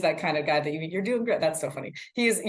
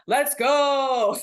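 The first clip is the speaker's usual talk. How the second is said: long, high, loud hype "GOOO"; excited cheer; 8 semitones higher, 5 dB louder than usual. Retold or imitated. Reporter rephrasing to show she's excited, drawn-out cheer. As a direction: stretch GO out in a big cheer. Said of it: "let me rephrase that".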